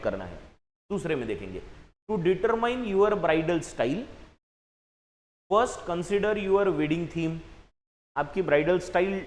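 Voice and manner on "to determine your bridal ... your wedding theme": slow, deliberate reading of the exercise sentence; teacher dictating a sentence; slow read-aloud. Shown as a demonstration. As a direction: read it out word by word for the class. Reads the English sentence aloud.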